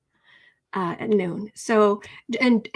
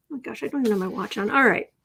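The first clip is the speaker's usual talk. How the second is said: usual speaking voice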